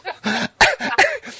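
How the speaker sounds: a sudden high squealing laugh; high cackle. Real, not acted. Telling his friend about cheering at the movie, high cackling laugh. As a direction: burst out in a shrill laugh at himself.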